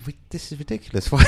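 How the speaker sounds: laughing at the silly row. Retold or imitated. Parent recalls a silly row with his wife, laughing. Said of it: "you just started laughing".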